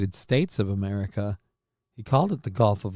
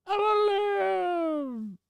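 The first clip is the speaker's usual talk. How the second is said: much higher, slow, stretched-out cartoon cry; drawn-out squeaky wail; 5 dB quieter, much slower than usual. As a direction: wail it slowly like a doomed cartoon critter.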